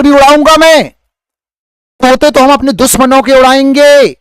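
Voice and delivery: high, loud fired-up shout; teacher shouting a boast to the live chat. Acted. Loud high shout. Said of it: "sir, गला ख़राब हो जाएगा, चिल्लाओ मत".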